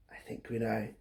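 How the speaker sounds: hushed secret whisper. Acted. Storyteller whispering the answer to little listeners. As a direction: lean in and whisper it.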